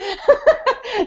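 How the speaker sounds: a bright high burst of laughter. Real, not acted. Laughing at herself about singing on stage, high laugh.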